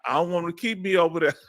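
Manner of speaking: ordinary delivery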